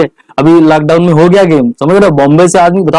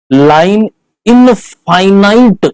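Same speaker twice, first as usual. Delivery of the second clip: slow, stretched-out syllables, pitched higher; drawn-out key term; 4 semitones higher, much slower than usual. Shown as a demonstration. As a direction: draw the term out slowly for the class. Key term drilled for class. (धीरे, खींचकर बोला गया शब्द)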